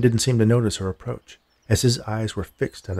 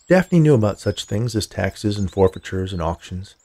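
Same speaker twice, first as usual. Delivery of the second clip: ordinary delivery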